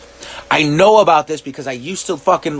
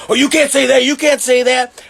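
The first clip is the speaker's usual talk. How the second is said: mock prissy scolding; 5 semitones higher than usual. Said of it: "so you're like".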